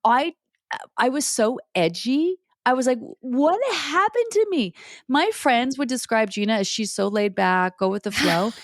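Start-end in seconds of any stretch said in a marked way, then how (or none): 3.2-4.7 high, slow bewildered question. Retold or imitated. Retelling her own confusion at changing, high and bewildered. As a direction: ask it as if lost, hammed up.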